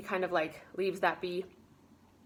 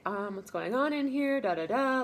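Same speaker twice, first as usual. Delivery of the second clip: higher, drawn-out questioning quote; imitated puzzled maid; 6 semitones higher than usual. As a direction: lightly act out someone asking what's up. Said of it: "Amelia's kind of like".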